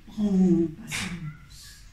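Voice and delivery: low, slow, booming whale; big whale voice. Acted. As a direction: speak slowly like a huge gentle whale. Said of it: "De sa grosse voix de baleine".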